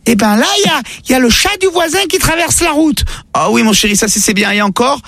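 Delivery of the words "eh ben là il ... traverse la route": put-on high little kid's voice; squeaky child answering mum.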